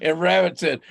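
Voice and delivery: usual speaking voice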